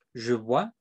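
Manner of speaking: "Je bois" said as French zhu bwa; French repeat. Shown as a demonstration. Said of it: "en francés sería".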